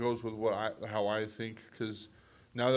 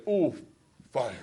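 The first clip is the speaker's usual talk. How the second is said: lightly voiced caveman discovery; mock caveman, word by word; a hint of primitive wonder; 3 semitones higher, 5 dB louder than usual. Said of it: "they're like".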